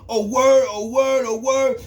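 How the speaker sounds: high, loud "oh, word" taken aback; startled, high retold reaction; mock surprised. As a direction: imitate him caught off guard. Voicing the old timer backing off.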